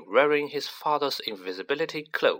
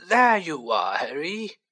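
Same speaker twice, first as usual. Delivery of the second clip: Fudge's greeting; 6 semitones higher, 4 dB louder than usual. A narrator's reading. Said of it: "said a voice".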